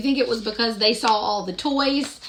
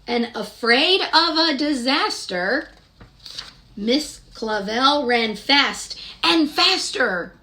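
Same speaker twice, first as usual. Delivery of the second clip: gentle, sing-song picture-book narration; storybook reading; 4 semitones higher, 5 dB louder, slower than usual. Acted. Reading Madeline aloud to young children, sing-song storytelling. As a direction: read the rhyme aloud to little listeners.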